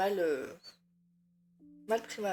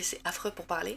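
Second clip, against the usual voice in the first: ordinary talk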